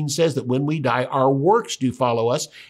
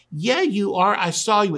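higher-pitched, insistent contradiction; insistent accusation; 7 semitones higher than usual. Acted. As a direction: act out a mildly heated accusation. Girl accusing Peter, acted.

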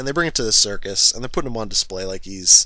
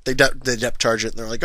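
ordinary talk

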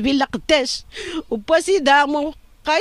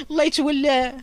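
ordinary talk, nothing marked

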